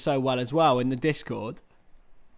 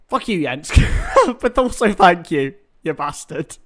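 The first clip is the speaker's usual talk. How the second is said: playful cursing broken by a laugh; happy, joking swearing; 5 dB louder than usual. Real, not acted. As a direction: swear at a mate in jest, laughing. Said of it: "it's a happy fuck you".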